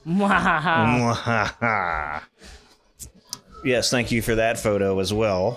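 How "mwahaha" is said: slow drawn-out evil laugh; mad scientist cackle. Pretend. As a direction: stretch the cackle out.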